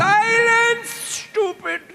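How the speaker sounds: angry shout. Acted. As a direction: snap silence at them. Wizard berates visitors.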